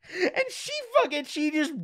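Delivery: high squeaky words through laughter; laughing. Real, not acted. Storyteller resumes the tale still cracking up, laughing. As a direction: struggle to talk while cracking up.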